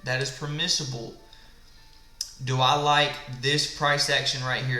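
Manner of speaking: ordinary talk, nothing marked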